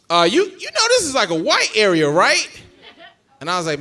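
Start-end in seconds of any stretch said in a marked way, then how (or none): 0.1-2.5 high, loud granddad voice; hammed-up concerned question, pitched up; wary granddad.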